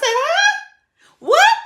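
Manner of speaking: very high, startled questions; shocked. Retold or imitated. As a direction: caught off guard. Retold shocked reaction.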